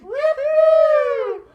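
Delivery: very high, loud woo-hoo; cheer. Acted. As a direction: shout it with glee. Cheering in the carol.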